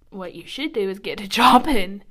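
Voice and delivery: laughing punchline. Real, not acted. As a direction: laugh through the last word.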